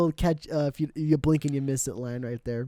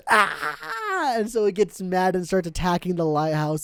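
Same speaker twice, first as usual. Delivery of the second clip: ordinary talk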